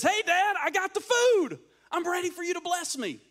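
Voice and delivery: high, loud, excited son bursting in; acted boisterous arrival; eager calling out. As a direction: announce yourself proudly, voice way up. Esau arrives expecting the blessing.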